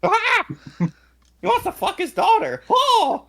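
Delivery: mock shocked commentator. Co-host voicing a scandalised commentator, mock shock. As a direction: gasp in hammed-up outrage.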